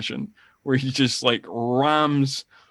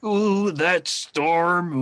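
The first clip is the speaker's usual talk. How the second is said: slow, drawn-out villain menace; higher-pitched, hammed-up villainy; mock movie villain; 5 semitones higher, much slower than usual. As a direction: draw it out like a hammy henchman. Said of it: "doing that kind of villainy thing".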